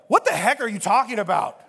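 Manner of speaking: a lightly acted what-the-heck; half-joking exasperated disbelief; incredulous retort.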